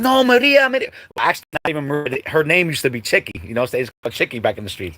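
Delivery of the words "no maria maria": high, loud call to the mom; calling the mom off from the street; neighbors calling out. Retold or imitated. Block dudes calling to the angry mom to stop. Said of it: "dudes that was on the block that was like".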